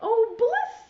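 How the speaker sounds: high, loud rising sigh of delight; blissful. Acted. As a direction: melt into the warm bath. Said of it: "says the pig with a happy grin".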